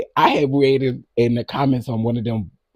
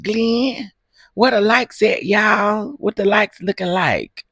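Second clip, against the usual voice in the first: mock call-out; 9 semitones higher, 3 dB louder than usual. Mock-hosting, calling out to Glenn and viewers.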